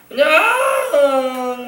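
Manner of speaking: high, drawn-out mock wail; mock childish yelling. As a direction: imitate a child hollering in a tantrum. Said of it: "소리 지르고 그러지 말고".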